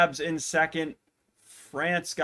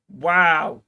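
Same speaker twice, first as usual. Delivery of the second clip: amazed wow; 2 semitones higher, 9 dB louder than usual. Real, not acted. Reacting to record run.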